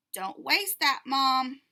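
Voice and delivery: loud, scolding call to Mom; child scolding mom, loud. Acted. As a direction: tell Mom off loudly.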